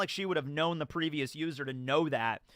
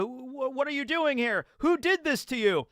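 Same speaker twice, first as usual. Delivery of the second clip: higher pitched, alarmed questioning; lightly voiced shocked Vegapunk; shocked Stella; 7 semitones higher than usual. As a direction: voice a startled old scientist. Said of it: "and so Stella".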